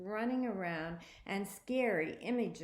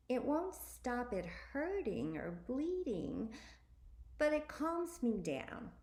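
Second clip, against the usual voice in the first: put-on higher, matter-of-fact girl's voice; high sister voice; 6 semitones higher than usual. A narrator's reading.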